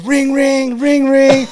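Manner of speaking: high, loud ringing-phone imitation; shrieked-out mock ringtone; deputy mimicking a phone. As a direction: imitate a DI calling out phone rings. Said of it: "and she goes".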